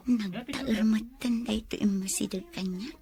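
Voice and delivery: hushed, unvoiced aside to herself; whispered aside. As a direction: whisper it so the stranger won't hear. Heidi's aside as stranger approaches. (ni Heidi nga agsarsarita iti bagina, agarasaas)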